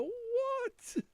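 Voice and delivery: high-pitched mock disbelief; incredulous. Dad imitates his son reacting to absurd quote, high incredulous squeal.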